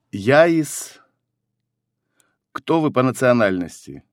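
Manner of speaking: ordinary talk, nothing marked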